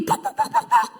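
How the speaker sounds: very high, sharp mock whacking sounds; broom whacks. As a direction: squeak out the broom hits way up high. Wife beating with broom.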